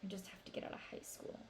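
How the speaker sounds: sagging into a hushed mutter; deflated. A narrator's reading. Brother's hope deflating as he mentions school, hushed and fast. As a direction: voice drops to a near-whisper, quick. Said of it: "then his voice deflates".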